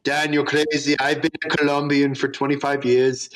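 put-on loose, lightly done Austrian accent; half-serious Austrian accent impression. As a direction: imitate a friend in a mock Austrian accent. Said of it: "this is in an Austrian accent".

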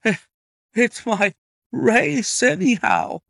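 high, loud, breathless exclamation; gasped. A narrator's reading. Colonel seeing his horse win, gasping. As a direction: gasp it out in halting bursts. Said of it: "gasped the colonel".

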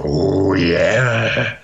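lightly voiced [name] oh yeah; a quick nod to Satchmo's catchphrase. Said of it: "[name] goes".

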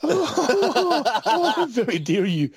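laughing, hammed-up indignation; playfully affronted; mock offence. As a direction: act scandalised through a laugh. Playing offended after being insulted.

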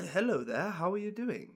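tentative attempt at a character voice, soft at the end; put-on greeting that misses the character; wrong Grondo voice. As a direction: grope for a forgotten character's voice. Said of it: "No, that wasn't Grondo".

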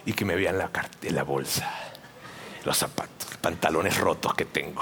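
very low, hushed inner voice, trailing into whisper; low, barely voiced thinking to yourself; secret thought. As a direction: mutter a private thought under your breath. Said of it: "has pensado".

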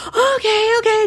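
put-on high, bright okay from a kid; eager little boy. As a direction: agree fast like an eager boy. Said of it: "boy answering".

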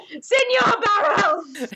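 high, drawn-out call; long, high cry without real volume; mock yell. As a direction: call out a challenge across a ballroom. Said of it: "striding across the ballroom yelling".

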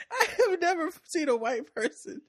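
high, tearful laughing voice; helpless high-pitched giggly talk; laughing through words. Real, not acted. Laughing while commenting on a clip.

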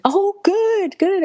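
very high, excited exclamation; high, gushing delight. Retold or imitated. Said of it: "I was so excited".

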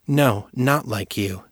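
slow, firm denial. A narrator's reading. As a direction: plant your feet on each word. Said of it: "Abby said firmly".

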